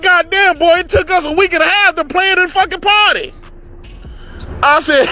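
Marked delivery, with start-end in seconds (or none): 0.0-3.3 high, mock-exasperated outburst; astonished uncle. Uncle who planned the party to nephew, astonished. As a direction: imitate a flabbergasted uncle in a raised pitch.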